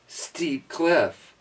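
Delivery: plain reading voice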